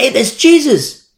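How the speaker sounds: high, loud, drawn-out call of recognition; excited shout. Retold or imitated. Disciples spot Jesus on the shore, excited shout. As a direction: call it out from the boat. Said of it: "and they say".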